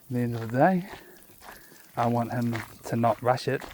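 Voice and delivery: ordinary talk, nothing marked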